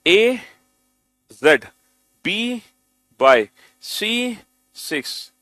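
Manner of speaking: very slow, drawn out chant of the pairs; slow recital. Shown as a demonstration. Teacher leading students in reading, very slow. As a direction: chant along slowly, leading the class. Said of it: "एक बार मेरे साथ भी पढ़ो".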